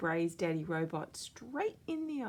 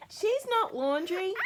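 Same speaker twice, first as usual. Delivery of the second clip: put-on high, loud indignant kid; high, loud protesting shout; child yelling; 6 dB louder than usual. A narrator's reading. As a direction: yell it to defend your sister. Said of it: "yells Bluey".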